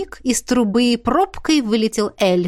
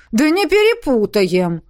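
high, raised protest; exclaimed; 4 semitones higher, 4 dB louder than usual. A narrator's reading. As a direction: call it out like eager elves. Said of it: "хором воскликнули эльфы". (дружно воскликнуть)

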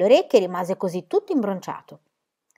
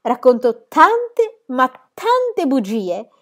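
tante, tante allungato e lentissimo; enfatico lento; 5 semitones higher, 6 dB louder, much slower than usual. Acted. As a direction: scandisci ogni parola come un annuncio. Pippi enfatizza le bugie. (Pippi che esagera, lentissima)

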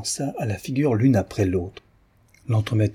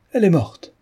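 higher, louder panicked shout; alarmed cry; 9 semitones higher, 6 dB louder than usual. A narrator's reading. Cook rushing in, crying out that the girl is dead. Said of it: "cria la cuisinière".

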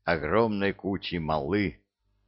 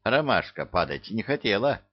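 ordinary delivery

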